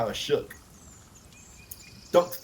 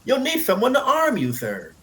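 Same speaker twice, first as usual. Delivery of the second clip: put-on much higher young boy; boy's voice; 12 semitones higher, 4 dB louder than usual. A narrator's reading. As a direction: play the eager little squire. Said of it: "the boy said".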